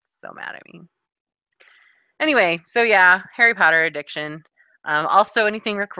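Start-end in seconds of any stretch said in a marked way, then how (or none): 0.0-0.8 whispered aside. Real, not acted. Quiet repeat of the phrase.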